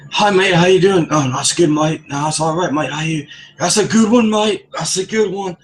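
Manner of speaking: high-pitched mock British "mate" chatter; English accent. Mimicking a coworker's chirpy greetings, English accent. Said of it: "English accent from Cambridge".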